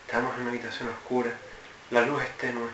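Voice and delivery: hushed, low, paused narration; más pausado. Shown as a demonstration. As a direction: set the scene softly, pausing between phrases. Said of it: "lo dices más pausado". (narración pausada, grave y en voz baja)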